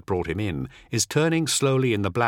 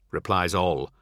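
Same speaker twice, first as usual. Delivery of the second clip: usual speaking voice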